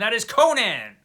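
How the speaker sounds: grand reveal; triumphant reveal. Real, not acted. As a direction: announce the winner proudly. Big reveal of his answer.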